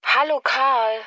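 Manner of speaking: ordinary talk, nothing marked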